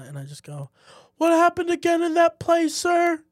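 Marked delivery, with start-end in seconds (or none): 1.2-3.2 high, loud fake-innocent question to the teacher; playing dumb, pitched way up and loud; mock innocent question.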